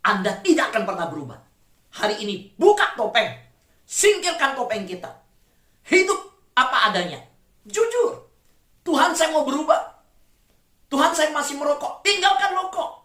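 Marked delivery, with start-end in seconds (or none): 2.6-8.3 loud, high urgent preaching; fiery preaching. Acted. Preaching climax. 8.8-12.0 pleading prayer. Acted. Congregant confessing in prayer. 12.0-13.0 high, loud command; commanding preaching. Acted. Preacher answers prayer.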